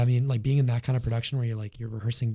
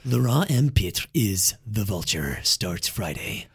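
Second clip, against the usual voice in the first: deep, slow trailer voiceover; movie trailer voice; 4 semitones lower, slower than usual. Pretend. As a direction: boom it out like a blockbuster ad. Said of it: "trailer voice you did right there".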